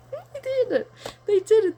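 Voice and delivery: high, loud thrilled squeal; delighted squeal. Real, not acted. Telling viewers the kids copied her, delighted squeal. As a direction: squeal with joy that they copied you. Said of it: "it just makes me so happy".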